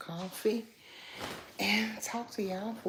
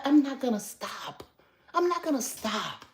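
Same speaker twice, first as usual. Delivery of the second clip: defiant; 9 semitones higher, 6 dB louder than usual. Real, not acted. Defiant vow against haters.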